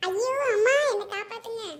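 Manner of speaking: loud, high yelp for mother; panicked cry for help. Acted. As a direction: yell out as if about to be beaten. Threatened brother yells for mother.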